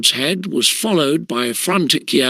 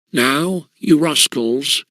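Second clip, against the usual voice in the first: plain reading voice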